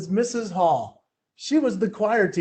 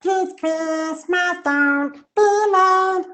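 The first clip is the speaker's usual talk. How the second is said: squeaky chipmunk singing voice, much higher, slow; 10 semitones higher, much slower than usual. Retold or imitated. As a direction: sing it like a cartoon chipmunk. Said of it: "I did Alvin and the Chipmunks".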